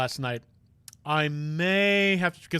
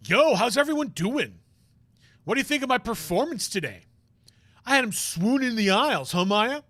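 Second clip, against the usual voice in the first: cocky show-off; 6 semitones higher than usual. Acted. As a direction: brag to friends about your big moment. Voices boastful friend character.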